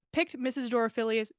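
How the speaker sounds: ordinary delivery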